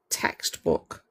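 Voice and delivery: breathy, hushed Textbook. for weight; quiet pointed repeat; hushed emphatic repeat. Real, not acted. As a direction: lean on Textbook. in a low hush.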